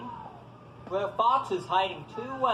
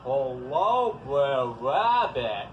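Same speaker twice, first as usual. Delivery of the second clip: much lower, slow booming voice; house-like voice; 7 semitones lower, much slower than usual. Acted. Fox pretending to be the house, deep slow voice. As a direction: drop it low and slow like a house talking. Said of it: "in his most house-like voice".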